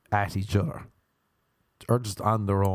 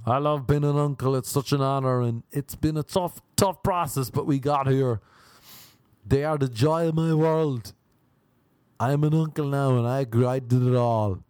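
proud uncle; 4 semitones higher than usual. Retold or imitated. Mocking proud new uncle.

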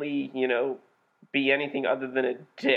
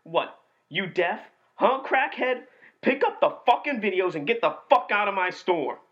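quoting the angry manager; 6 semitones higher than usual. Acted. Quote war, manager yelling a customer out of the store. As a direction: scream at the crackhead customer. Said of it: "I'm gonna quote the movie".

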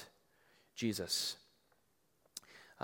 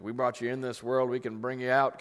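a hint of a stern parent. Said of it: "your parents probably have said that to you".

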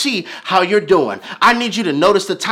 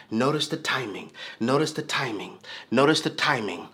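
much softer, lower repeated refrain; hushed refrain; 6 semitones lower, 9 dB quieter than usual. Acted. Preacher repeating refrain to the church, hushed. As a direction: keep the refrain soft and low.

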